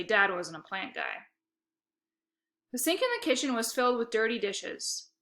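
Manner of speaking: ordinary delivery, nothing marked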